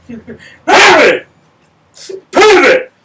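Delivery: loud, high barked command; drill sergeant yell. Retold or imitated. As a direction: bark it at the whole warehouse. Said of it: "I had the loudest voice".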